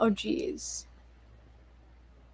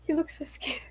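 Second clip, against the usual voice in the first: whisper; 5 semitones higher, 8 dB quieter, faster than usual. Real, not acted. Laughing aside about game character.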